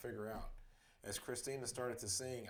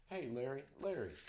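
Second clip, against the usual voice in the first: higher call after someone leaving; 4 semitones higher, 3 dB louder than usual. A narrator's reading. Bandmates to fleeing friend, calling out. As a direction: call the name out, mildly raised. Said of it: "I heard Christina and Jared calling".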